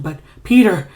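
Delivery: voice breaking. Acted. Ignatius telling Peter about his father, voice breaking. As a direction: let the voice crack as he calls for Peter. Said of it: "and his voice breaks".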